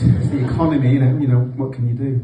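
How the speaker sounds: ordinary delivery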